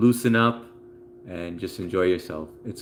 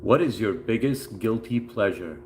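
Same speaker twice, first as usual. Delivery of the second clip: ordinary delivery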